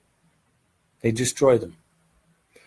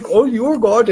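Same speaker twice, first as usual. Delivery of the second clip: much higher, louder adoring gasp; fawning worshipper; 11 semitones higher, 7 dB louder than usual. Retold or imitated. Teacher mimicking worshippers adoring a guru, in gushing awe. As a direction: gush like a starstruck follower. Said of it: "Oh, they".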